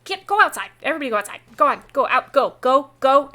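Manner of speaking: loud high commands, building; shooing dogs. Acted. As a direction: drive the dogs out the door.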